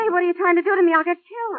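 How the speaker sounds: high, loud panicked cry; she's terrified of being set up as bait; frightened protest. Acted. Woman panics at the detective's plan, loud and frightened.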